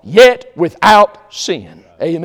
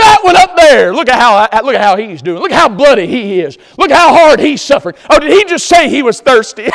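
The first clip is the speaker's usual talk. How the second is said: high, loud cackling taunt; preacher acts a crowd taunting the dying man; mocking jeer; 6 semitones higher, 6 dB louder than usual. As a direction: jeer up at the cross, louder and higher.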